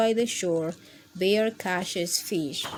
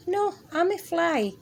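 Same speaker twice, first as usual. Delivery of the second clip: little fly voice; 8 semitones higher than usual. Acted. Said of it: "says Fly".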